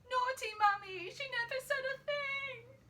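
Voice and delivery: put-on high-pitched wail of a child's complaint; crying out. A narrator's reading. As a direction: wail it like a betrayed little girl. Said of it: "burst out crying".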